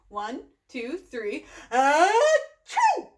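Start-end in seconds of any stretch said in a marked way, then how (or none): 1.7-3.1 loud two-part "ah-choo"; pretend sneeze. Shown as a demonstration. Showing Gerald how to sneeze, pretend sneeze. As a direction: build up and burst into a mock sneeze. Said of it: "we're gonna pretend to sneeze".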